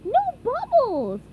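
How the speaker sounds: high, loud, slow cheer; thrilled; excited. A narrator's reading. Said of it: "said SpongeBob excitedly".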